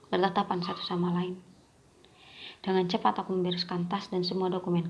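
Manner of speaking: usual speaking voice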